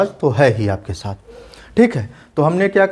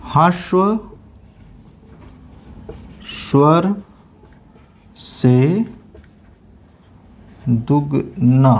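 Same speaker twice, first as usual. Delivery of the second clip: each word spaced out, very slow; slow dictation; 3 semitones lower, much slower than usual. Shown as a demonstration. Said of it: "इसकी परिभाषा क्या है?". (धीमे-धीमे परिभाषा लिखवाना)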